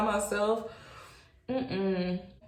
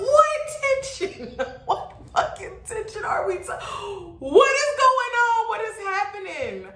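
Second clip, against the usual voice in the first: exasperated disbelief; 13 semitones higher than usual. Retold or imitated. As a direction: wail it in hammed-up bafflement. Acting out disbelief at claimed tension.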